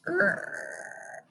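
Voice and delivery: soft unvoiced creak like a floor; quiet creaking floor sound; creaking noise. Shown as a demonstration. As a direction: breathe out a creaky err. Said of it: "Make a creaking noise with me".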